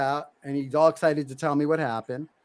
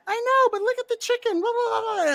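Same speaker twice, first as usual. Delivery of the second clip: put-on much higher, giddy little boy voice; excited kid voice. Son voiced again, pleading.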